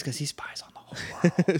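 whispered like a secret; conspiratorial whisper. Pretend. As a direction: hush it as if sharing spy gossip.

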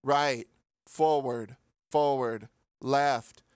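flat bored monotone. Retold or imitated. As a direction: drone each word, no interest. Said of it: "Just monotone the whole time".

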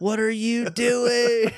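high, slow, incredulous what are you doing; stretched-out mock outrage; mock disbelief. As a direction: drag it out in disbelief. Mock outrage at a booking idea.

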